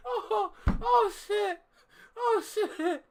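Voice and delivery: high-pitched, breaking into laughter; laughing disbelief. Real, not acted. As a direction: squeal it through helpless laughs. Overcome with laughter and shock.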